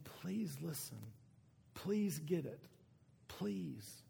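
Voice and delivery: Jesus begging his listeners to get it; desperate pleading. Pretend. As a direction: plead quietly, almost breaking. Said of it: "I almost hear a desperation in Jesus' voice".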